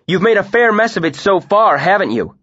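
loud, high sneering jab; angry jeer. A narrator's reading. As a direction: rub it in. Quarrel, mocking jab.

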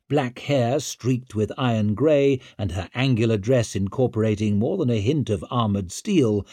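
usual speaking voice

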